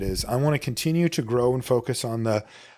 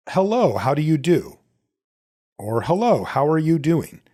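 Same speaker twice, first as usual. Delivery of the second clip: textbook English; 4 semitones higher than usual. Retold or imitated. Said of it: "they might teach you something like".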